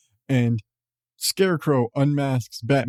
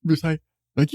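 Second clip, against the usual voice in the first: ordinary delivery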